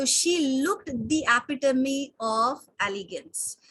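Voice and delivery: each word spaced out very slowly; slow, careful teacher read; slow example sentence. Shown as a demonstration.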